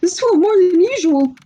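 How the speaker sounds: put-on high voice. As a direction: squeak it out in a silly voice.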